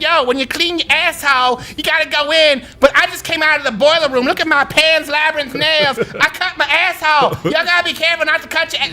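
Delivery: a playful take on her voice. Retold or imitated. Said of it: "She's like".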